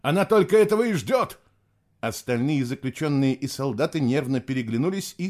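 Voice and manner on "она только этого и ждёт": high, loud urgent warning; warning shout. A narrator's reading. Nicholas warning that the ghost is waiting, shouting. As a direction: keep shouting to warn her. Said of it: "предупреждающе крикнул Николас".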